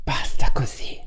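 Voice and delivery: hushed breathy whisper; whispered Italian sign-off. Acted. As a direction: drop to a whisper to close. Dramatic whispered close to intro.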